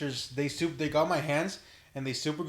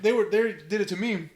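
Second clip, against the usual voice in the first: ordinary talk, nothing marked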